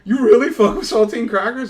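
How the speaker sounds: loud, high, disbelieving what; disbelieving outburst. Retold or imitated. To reddit users defending saltines, a loud incredulous outburst. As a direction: shout it in total disbelief. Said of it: "And I'm like".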